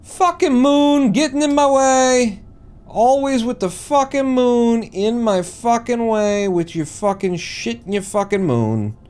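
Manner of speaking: high, loud, drawn-out complaint; angry rant. Real, not acted. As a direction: rail at the thing blocking your way. Ranting at the moon in the way.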